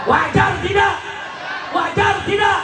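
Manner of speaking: very high, loud call; belted rally question; rallying shout. Real, not acted. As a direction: demand the crowd's agreement at full voice. Rallying crowd for a response.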